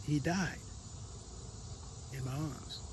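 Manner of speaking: soft, sorrowful fade; quiet grief. Real, not acted. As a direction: let it drop to near nothing. Grief at father's death.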